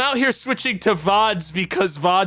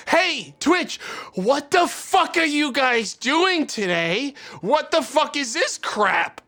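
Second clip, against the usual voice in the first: mock outrage; 7 semitones higher, slower than usual. Acts out community's outraged complaint.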